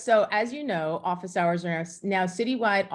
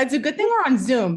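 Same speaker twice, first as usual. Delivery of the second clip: higher and louder, still overwhelmed; excited gush; 6 semitones higher, 7 dB louder, faster than usual. Real, not acted. Still reeling from the tribute, excited and louder. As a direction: keep the emotional rush going. Emotional reaction continues.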